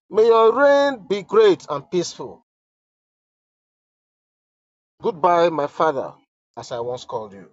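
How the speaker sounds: solemn dramatic reading; letter read aloud. Acted. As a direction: read each line as if it were the queen's own plea.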